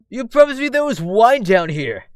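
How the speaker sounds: loud, high-pitched protest; aggrieved shout. Acted. Ernest protesting that Lucian tricked him, loud aggrieved shout. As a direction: cry out at being tricked.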